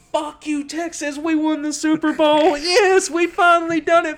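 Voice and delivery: high, loud triumphant yell at the whole state; gloating scream. Retold or imitated. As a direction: scream the taunt at the top of your lungs. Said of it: "screaming at the top of my lungs".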